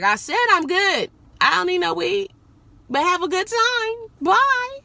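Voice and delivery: high-pitched dismissive goodbye, acted out; acted brush-off. Driver retelling how they turned down a passenger's offer, high dismissive brush-off.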